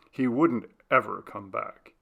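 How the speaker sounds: ordinary delivery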